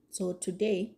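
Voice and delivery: usual speaking voice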